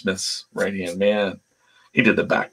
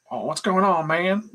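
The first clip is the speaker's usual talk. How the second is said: high, almost excited faux-hillbilly greeting; Robert Kirkman; 10 semitones higher than usual. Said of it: "excitedly almost".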